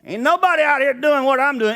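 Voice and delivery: a lightly voiced cocky prophet; proud Elijah. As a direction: brag like the only one who matters. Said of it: "He had a little ego problem".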